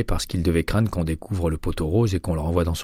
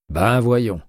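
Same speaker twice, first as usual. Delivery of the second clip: scornful, louder and higher; contemptuous; 5 semitones higher, 6 dB louder than usual. A narrator's reading. Arango brushing off McCaleb, contemptuous and louder. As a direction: sneer it out, louder. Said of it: "d'un ton méprisant".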